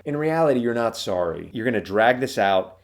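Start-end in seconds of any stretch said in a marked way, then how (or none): none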